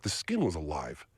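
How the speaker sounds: normal talking voice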